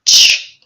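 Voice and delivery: "Ch" as a hissed, voiceless ch; ch sound. Shown as a demonstration. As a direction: push out a sharp voiceless "Ch". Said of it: "put your hands on your chin and pronounce".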